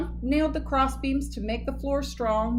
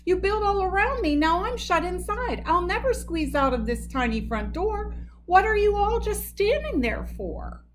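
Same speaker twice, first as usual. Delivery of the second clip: complaining; 6 semitones higher than usual. A narrator's reading. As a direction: whine it from inside. Moose trapped, complaining.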